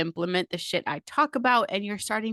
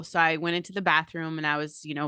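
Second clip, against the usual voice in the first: ordinary talk, nothing marked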